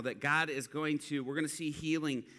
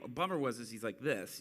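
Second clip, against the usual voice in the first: usual speaking voice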